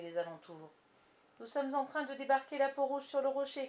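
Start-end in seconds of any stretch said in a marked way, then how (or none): 1.4-3.7 raised, higher pirate's shout; calling out. A narrator's reading. Smee reporting to the captain, calling out. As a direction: shout a report over the water. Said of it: "cria Smee".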